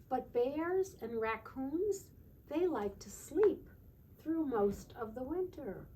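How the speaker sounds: wide-eyed storybook telling; reading to children. Acted. Reading picture book aloud.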